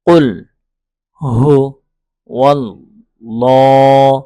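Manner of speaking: loud, drawn-out syllables, one at a time; spelling out recitation. Shown as a demonstration. Teacher breaking down the verse for learners, loud slow recitation. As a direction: sound out each syllable at full length. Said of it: "একটু বানান করে পড়ি".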